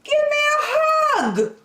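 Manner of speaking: high excited plea for a hug; eager cry. Acted. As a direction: call out to the sheep longingly. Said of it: "cried Douglas".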